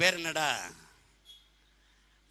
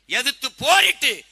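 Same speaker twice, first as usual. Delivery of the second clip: high, loud, drawn-out rally cry; defiant shout; 7 semitones higher, 9 dB louder than usual. Real, not acted. Politician recalling his fight to the crowd, defiant shout.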